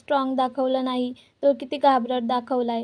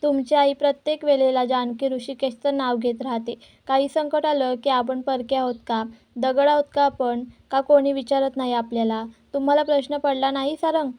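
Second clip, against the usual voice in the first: ordinary delivery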